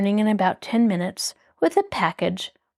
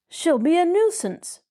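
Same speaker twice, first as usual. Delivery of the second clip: higher, protesting voice; 9 semitones higher than usual. Acted. Bud protesting to Tom, voice pitched higher. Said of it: "Bud protested".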